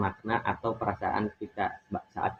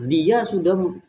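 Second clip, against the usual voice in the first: angry snapping tone; 7 semitones higher, 5 dB louder than usual. Shown as a demonstration. Student showing listeners how anger sounds, raised snapping tone. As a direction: demonstrate anger with a high, forceful intonation. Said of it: "seperti menyentak seperti itu".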